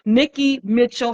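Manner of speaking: ordinary talk, nothing marked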